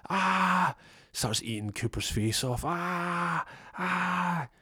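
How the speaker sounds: high short mock screams. Cooper screaming as the dog eats him, mock. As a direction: squeal as the dog attacks.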